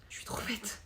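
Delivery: murmure pour soi, dépité; chuchoté. Real, not acted. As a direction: se gronder tout bas. Se reproche son oubli. (chuchoté à soi-même)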